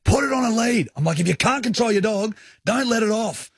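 high, indignant scolding, words hit hard; retold telling-off, pitched right up; going off. Dog owner scolds a careless stranger, going off. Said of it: "I'm going off".

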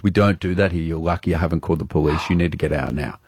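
low, stern warning from a barman; a lightly voiced barman telling him to leave. Barman warns the customer to leave, low and stern. Barman threatens to call police.

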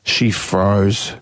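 ordinary talk, nothing marked

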